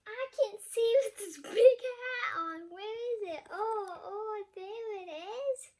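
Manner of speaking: loud, high fumbling voice; voicing Snowy lost under a big hat; muffled under hat. Child voicing Snowy stuck under a big Christmas hat. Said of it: "I'm like".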